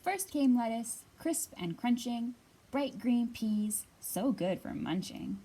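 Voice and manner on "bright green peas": ordinary delivery, nothing marked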